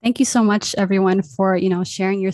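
loud host thanks. Real, not acted. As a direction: open big and bright to everyone.

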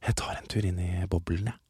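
mock hushed spa small talk; spa whisper. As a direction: whisper like a polite spa guest. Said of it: "til å være veldig sånn".

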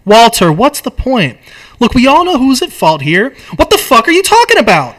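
loud, high, rising frustration; acted outburst at a ranting friend; exasperated argument. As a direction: argue back, building to a shout. Acted script quarrel.

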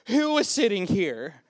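loud, drawn-out mock demand; teacher's outburst. Teacher confronting kindergartners over the puddle, mock outburst. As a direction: imitate a teacher blowing her cool.

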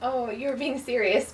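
normal talking voice

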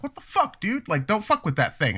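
put-on sharp high scolding of a kid. Father scolding his son in the store, sharp and high. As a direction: snap at the kid to stop touching things.

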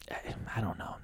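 breathy unvoiced whisper; whispered aside. Real, not acted. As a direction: drop to a whisper, unsure. Trailing off mid-thought.

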